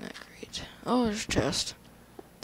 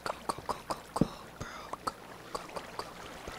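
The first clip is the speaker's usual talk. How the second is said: whispering; 8 dB quieter, much faster than usual. Real, not acted. Urging himself on in game.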